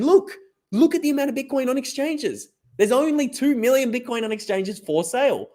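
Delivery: high, excited mock yell; mock screaming. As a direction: shout it like a worried crank online. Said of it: "pointing and screaming".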